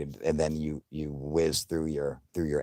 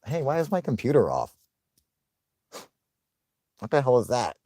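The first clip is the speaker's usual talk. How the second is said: confused alarm; 6 semitones higher, 4 dB louder than usual. Retold or imitated. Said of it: "And I'm like".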